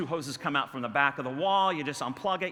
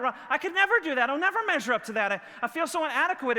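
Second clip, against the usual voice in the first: defeated protest; 10 semitones higher, 4 dB louder than usual. Acted. Voices someone feeling inadequate beside David.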